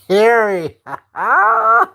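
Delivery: laughing through the name; cracking up mid-word. Real, not acted. Mocking Carrie's shirt while laughing.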